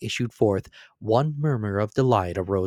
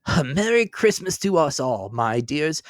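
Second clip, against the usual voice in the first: a lightly voiced father raising a toast; a father's cheerful Christmas toast; hearty toast; 5 semitones higher than usual. A narrator's reading. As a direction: toast the family with a lift in the voice. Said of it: "Then Bob proposed".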